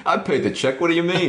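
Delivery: put-on high, brash American accent, laughing; Mike Demetrio's accent. Friend mocks the promoter dodging checks, American accent. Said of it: "excuse for you to do that accent".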